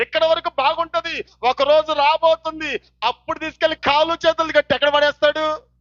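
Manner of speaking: high, driving warning from the pulpit; full-force preaching. Real, not acted. Preacher warning those who cover their sins, full-force preaching. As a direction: build a list of warnings, each phrase falling at its end.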